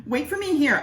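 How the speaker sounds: high bossy order; bossy wife. Acted. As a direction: order him to wait.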